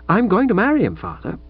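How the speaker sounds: lightly voiced young woman, set on her own way; a hint of a stubborn daughter standing firm; defiant daughter. Pauline defies her father.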